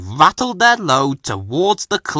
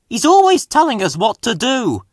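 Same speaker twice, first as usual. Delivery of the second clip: higher whiny complaint; moaning; 10 semitones higher, 3 dB louder than usual. A narrator's reading. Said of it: "moaned Bill".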